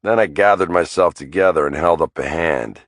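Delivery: plain reading voice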